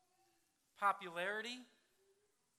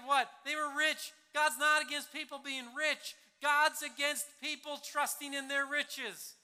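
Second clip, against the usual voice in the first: raised, louder, higher preaching voice; forceful preaching; 6 semitones higher, 10 dB louder than usual. Real, not acted. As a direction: proclaim it boldly to the room. Preacher driving home point.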